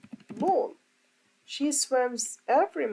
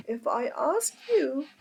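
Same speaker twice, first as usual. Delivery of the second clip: ordinary delivery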